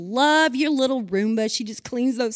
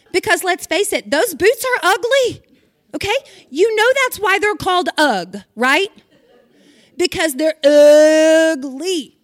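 higher and louder, laughing at the boots; half-laughing, half-ranting about ugly boots; excited mock outrage; 6 semitones higher, 8 dB louder than usual. Real, not acted. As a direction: build to a loud, stretched-out punchline.

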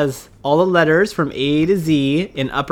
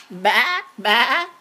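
playful bleating, high and laughing; sheep bleat; 7 semitones higher than usual. Pretend. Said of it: "What does the sheep say?".

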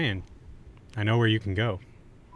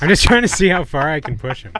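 laughing; 4 semitones higher, 4 dB louder than usual. Real, not acted. Teasing, laughing.

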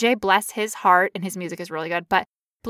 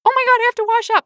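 high, loud panicked squeal; a hammed-up flustered cry; mock panic; 12 semitones higher, 6 dB louder, much faster than usual. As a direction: squeal it like you just saw blood. Retold panic, running to bathroom.

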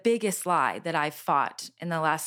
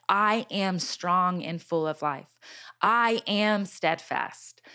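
slow declaration; slower than usual. Retold or imitated. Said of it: "It was I am statements".

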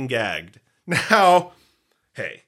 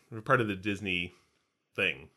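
usual speaking voice

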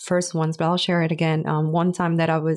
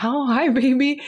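sweet surprised greeting; 7 semitones higher than usual. Retold or imitated. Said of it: "And I was like".

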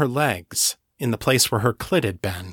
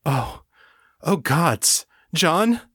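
rising panic; 5 semitones higher, slower than usual. A narrator's reading. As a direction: voice climbing into panic. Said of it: "abruptly changed ... to panic".

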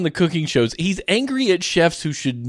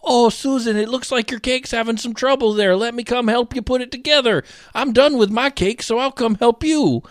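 high, sweetly helpful baker; helpful contestant; 9 semitones higher than usual. Retold or imitated. Baker offering Susan help, mock sweet. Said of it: "British Bake Off, and they're like".

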